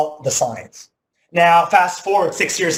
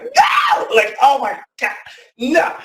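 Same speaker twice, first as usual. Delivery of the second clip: scream of disgust; 9 semitones higher, slower than usual. Retold or imitated. Seeing roach antennas in the sink, screaming. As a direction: yelp like you just saw a roach. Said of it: "I heard you, like, scream".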